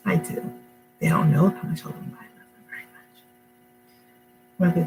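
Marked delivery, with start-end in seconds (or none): 1.5-3.2 a fast, tender whispered confession. Real, not acted. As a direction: breathe it out as a whisper. Mom confessing love for sons.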